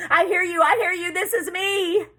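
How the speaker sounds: loud, very high, urgent shouting; frantic shout. A narrator's reading. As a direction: shout desperately to the voice on the radio. Boy answering rescuer over radio.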